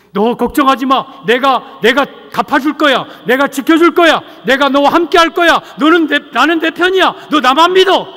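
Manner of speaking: emphatic reassurance. Acted. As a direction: promise fiercely that you are on their side. Voices God's forceful promise of protection.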